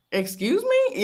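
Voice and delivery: high, rising incredulous question; put-on "come again?" reaction; mock disbelief. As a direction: act taken aback, pitch shooting up. Acts out reaction to his demand.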